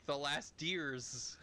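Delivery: slow, deliberate The last deers; drawn out The last deers as a joke; mock mistranslation. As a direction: spell out The last deers slowly. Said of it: "it'd be like".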